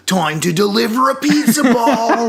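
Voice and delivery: high, loud catchphrase impression; Eric Andre. Podcast host showing off one of the actor's classic voices, hammed-up impression. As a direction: belt it out as a hammed-up character voice. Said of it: "Such as the classic".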